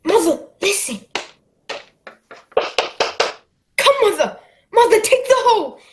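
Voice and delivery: put-on boy urging his mother to run; urgent pleading. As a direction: hurry her along, begging loudly. Jim urging mother to flee.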